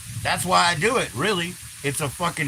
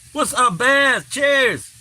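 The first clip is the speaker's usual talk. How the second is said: high excited greeting; 9 semitones higher, 5 dB louder, much slower than usual. Real, not acted. Greeting a viewer by name.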